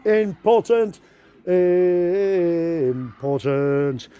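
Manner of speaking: "important" thrown high, then stretched long and slow; stressed insistence. Retold or imitated. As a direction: hammer home "important", dragging the last one out. Insisting to the council officer.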